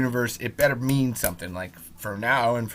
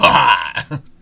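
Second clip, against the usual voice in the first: loud laughing burst; 10 dB louder than usual. Real, not acted. After mock high.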